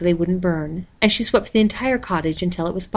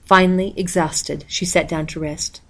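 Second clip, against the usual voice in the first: usual speaking voice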